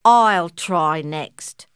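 slow eager. Acted. Koala volunteers.

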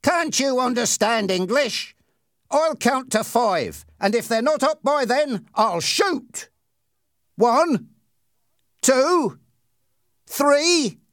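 high-pitched threatening countdown; high, insistent young tough's threat; bullying Ernie, menacing. Acted.

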